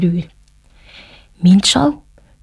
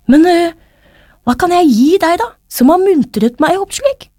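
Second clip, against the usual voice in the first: higher-pitched, questioning character voice; Reidar's storybook voice; 7 semitones higher than usual. A narrator's reading. Reidar asks Thomas what to give in return, high character voice. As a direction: voice the grateful friend in a raised pitch.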